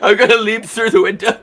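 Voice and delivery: high, loud in-character cry; hammed-up panicky declaration; frantic escapee. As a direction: blurt the escape plan in a squeal. [name] announces his escape in character.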